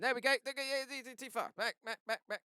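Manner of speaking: flustered, high-pitched panic; sudden real panic, voice shooting up; panicked. Real, not acted. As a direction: rattle it out in a loud, high fluster. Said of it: "don't know why I panicked so much".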